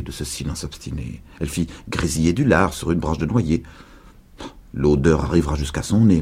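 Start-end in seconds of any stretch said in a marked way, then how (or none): none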